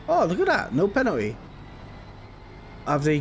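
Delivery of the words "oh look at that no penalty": sudden happy surprise; surprised delight. Real, not acted. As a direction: pitch jumps up in surprise. Surprised no penalty given.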